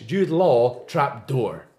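"Jude Law trap door" slowly in a Scottish accent, R sounded in "door". Shown as a demonstration. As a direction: keep "Law" and "door" from rhyming, Scottish style. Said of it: "But if you're Scottish".

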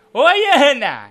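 loud greeting. Real, not acted. Host greets caller coming back.

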